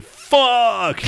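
high shocked fuck; retold jump-scare yell; mild mock yell; mock scream. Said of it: "I screamed out on that one too".

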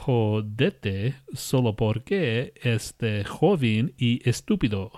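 slow, sounding out the Spanish; halting reading. Shown as a demonstration. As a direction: read it word by word, unsure. Reads Spanish phrase haltingly.

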